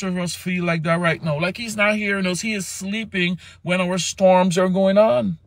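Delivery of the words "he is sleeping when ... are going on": ordinary talk, nothing marked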